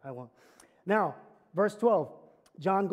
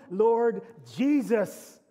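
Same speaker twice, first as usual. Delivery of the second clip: prayerful plea; 3 semitones higher, 6 dB louder, much slower than usual. Acted. Prayerful cry after Amen.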